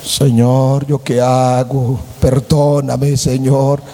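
low, slow, pleading voice; dismayed plea. Acted. Young man at church pleading after the plain girl sits down. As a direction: beg God to undo it.

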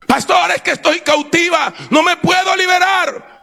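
high-pitched cry of someone trapped; acted anguished shout; desperate plea. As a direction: call out to the pastor for help, voice way up. Voices a captive pleading with pastor.

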